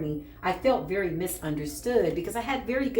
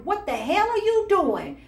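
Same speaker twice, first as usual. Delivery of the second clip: much higher, louder scolding; angry ancestor; 13 semitones higher, 4 dB louder than usual. Retold or imitated. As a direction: demand an answer from a furious elder. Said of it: "they were angry".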